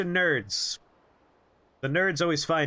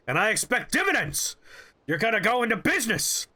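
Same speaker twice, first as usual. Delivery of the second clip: raised, high-pitched ranting boss; imitated shouting rant; 5 semitones higher than usual. Boss lecturing a student on business, shouted rant. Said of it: "he's just shouting at me".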